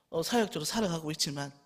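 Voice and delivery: ordinary delivery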